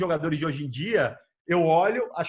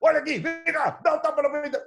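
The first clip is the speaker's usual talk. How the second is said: laughing call-out; 8 semitones higher, 4 dB louder than usual. Retold or imitated. Rodman calling the women over.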